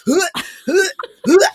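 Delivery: high retching huh huh; mock gag at the cat smell; gagging sounds. Driver gagging at stinky cats, high gags. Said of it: "I was, like, gagging".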